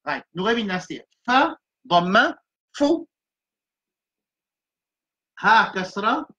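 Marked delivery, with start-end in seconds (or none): none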